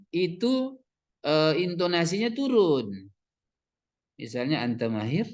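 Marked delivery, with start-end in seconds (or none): 4.6-5.3 "anta mahir" rising at the end; questioning intonation. Shown as a demonstration. Teacher shows a question mark, rising tune on "anta mahir". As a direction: ask "anta mahir" with an upturn on "mahir". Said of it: "nanya dia itu".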